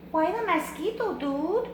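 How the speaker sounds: high, squeaky little-dog voice; smallest Chihuahua. Acted. Smallest Chihuahua asks about the mask, high squeaky voice. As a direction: pipe up like the tiny one. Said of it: "asked Paquito Tito".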